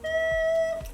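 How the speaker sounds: normal talking voice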